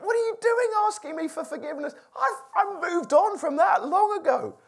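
a hint of Joseph, high and dismissive of old wrongs; Joseph forgiving. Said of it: "he thought".

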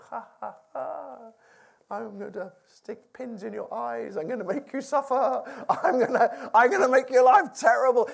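hammed-up villain laugh then gloating threats; mock villain. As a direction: play a cartoon bad guy relishing revenge. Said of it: "Imagine it every day".

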